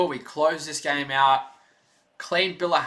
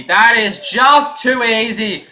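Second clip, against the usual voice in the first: louder, slow emphatic commentary call; excited; 6 semitones higher, 9 dB louder, slower than usual. Acted. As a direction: lean into it, savour the play. Repeats line, second louder.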